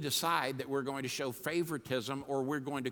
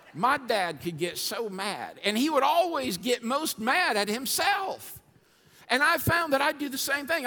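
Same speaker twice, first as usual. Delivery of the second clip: fired up; 10 semitones higher, 6 dB louder than usual. Real, not acted. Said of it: "'cause I'm fired up".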